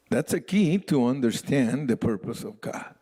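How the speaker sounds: ordinary talk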